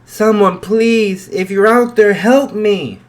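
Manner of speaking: high, loud desperate yell; terrified shout for help; screaming for help. Acted. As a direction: scream it for your life. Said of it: "he screamed his lungs out".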